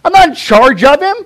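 high, loud, careless brush-off; casual attitude. Retold or imitated. Cain answering God casually, high and careless. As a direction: shrug it off like a teenager. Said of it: "how casual is their relationship".

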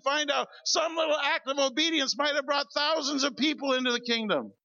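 fervent pulpit preaching; preaching at full force. Acted. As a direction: preach it out to the crowd, slowing to land each line.

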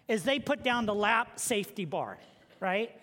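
usual speaking voice

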